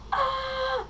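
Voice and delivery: high, short scream of fright; high-pitched hammed-up yelp; mock scream. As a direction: let out a pretend frightened cry. Victim reacts to villain.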